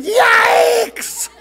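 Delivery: very high, loud drawn-out shriek; startled yell. A narrator's reading. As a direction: shriek like a horrified dad. Father horrified at full cart.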